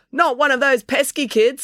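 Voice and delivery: high-pitched shout from the crowd; heckling call. A narrator's reading. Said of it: "someone called".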